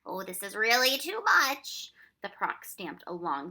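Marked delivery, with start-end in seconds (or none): 0.0-1.9 high, loud, slow exasperation; annoyed outburst. A narrator's reading. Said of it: "in annoyance".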